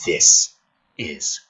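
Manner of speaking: hushed dramatic screenplay reading. Acted. Reads villain monologue.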